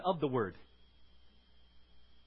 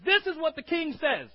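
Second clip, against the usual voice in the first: loud, higher herald announcing; herald's proclamation; 8 semitones higher, 7 dB louder than usual. Retold or imitated. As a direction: proclaim it to the gathered city. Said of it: "and he says".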